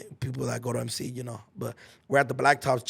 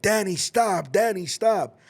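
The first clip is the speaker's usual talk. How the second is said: higher, louder call to stop; teacher calling out; 6 semitones higher, 4 dB louder, slower than usual. Retold or imitated. The English teacher yelling at him to stop the fight. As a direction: break it up with a firm call. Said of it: "So he's like".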